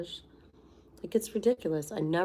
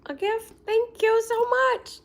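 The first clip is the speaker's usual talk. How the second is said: much higher and louder, slow gushing thanks; thrilled; 10 dB louder than usual. Real, not acted. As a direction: gush over the gift. Thrilled at a gift.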